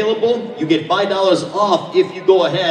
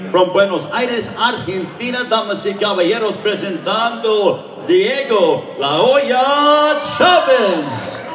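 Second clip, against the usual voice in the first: lucha libre announcer. Real, not acted. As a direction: stretch the fighter's name and build to a shout. Spanish fighter introduction, drawn-out call.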